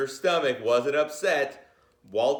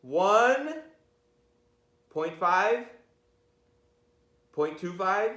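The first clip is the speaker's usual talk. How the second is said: building countdown, higher and louder; playful countdown; 6 semitones higher, 3 dB louder, slower than usual. Pretend. Teacher counting down to opening the book, playfully excited. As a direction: count them down like a launch. Mock countdown before reading.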